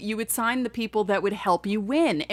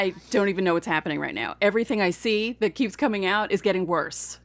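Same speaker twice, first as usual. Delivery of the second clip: ordinary talk, nothing marked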